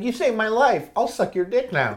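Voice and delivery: high teasing imitation of a grateful girl; mock girl's offer. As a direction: lift it way up, a cheeky fake come-on. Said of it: "Is she like".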